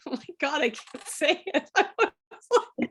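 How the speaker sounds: laughing. Real, not acted. Laughing at own mispronunciation.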